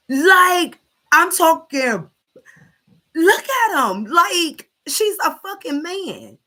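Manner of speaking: high, loud, disgusted outburst; real outrage at the clip, high and loud; outraged. Real, not acted. Outraged at the video.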